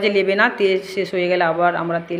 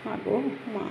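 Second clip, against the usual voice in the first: soft devotional call; 2 semitones higher, 13 dB quieter than usual. Real, not acted. Calling softly to goddess.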